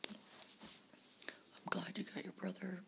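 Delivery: ordinary talk, nothing marked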